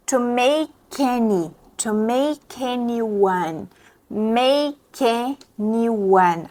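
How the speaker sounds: to make anyone drawn out very slowly, words linked; slow connected speech. Shown as a demonstration. Teacher slowly demos linked to make anyone. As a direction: stretch each linked sound slowly. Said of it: "dica de connected speech".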